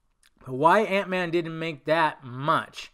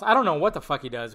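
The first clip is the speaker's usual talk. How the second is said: ordinary talk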